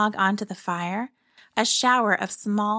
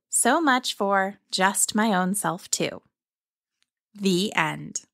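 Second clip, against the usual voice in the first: story narration; slower than usual. A narrator's reading. Storyteller's closing line.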